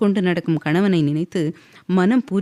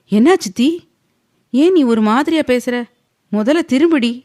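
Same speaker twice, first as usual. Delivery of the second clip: worried call; 6 semitones higher, 5 dB louder than usual. A narrator's reading. Husband worried at wife's tone.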